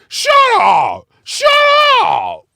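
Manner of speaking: high, loud shouted shut up. Retold or imitated. Retelling the Seven Eleven clerk, imitated shout. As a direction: bark it like the angry store clerk.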